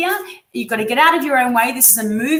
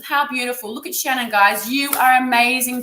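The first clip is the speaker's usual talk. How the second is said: normal talking voice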